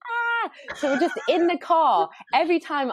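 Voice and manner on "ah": mock scream. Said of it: "George starts going".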